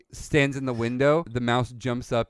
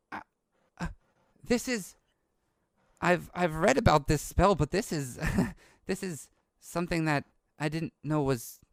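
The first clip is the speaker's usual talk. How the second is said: put-on higher, halting, wonderstruck boy; hushed-in-awe young scholar, words faltering; awed young boy; 7 semitones higher than usual. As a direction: stammer in amazement at the discovery. Game master plays awed young boy.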